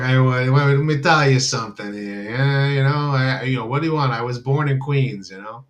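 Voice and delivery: put-on brash New York accent, a touch pushy; hammed-up New York accent, mock tough guy. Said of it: "I sound like a New York".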